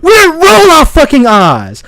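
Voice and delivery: high, indignant shout; real angry outburst; screaming. Real, not acted. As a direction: shout it in exasperation. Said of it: "I'm screaming".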